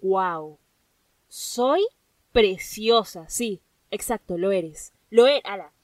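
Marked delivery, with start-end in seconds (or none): 0.0-3.3 mirror affirmation. Pretend. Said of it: "te mires al espejo y digas".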